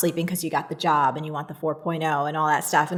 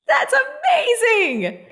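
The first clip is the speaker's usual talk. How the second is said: much higher, slow, delighted laugh; 3 dB louder, slower than usual. Real, not acted. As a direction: laugh in amazement at her story. Host reacts to the story.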